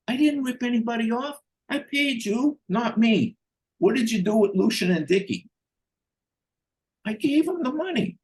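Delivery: squeaky panicked excuse; high whiny denial. Retold or imitated. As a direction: do the cornered liar way up high. The ripper-off denying, squeaky.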